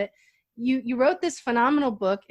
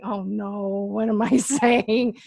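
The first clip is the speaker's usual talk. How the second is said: slow, lower "oh no" of realisation; retold dawning dread; 3 semitones lower, slower than usual. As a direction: act out realising the blunder, drawing it out.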